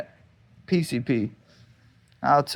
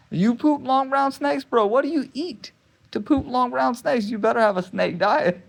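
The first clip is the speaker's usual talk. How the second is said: mock disbelief; 9 semitones higher than usual. Mocking the doctor's advice.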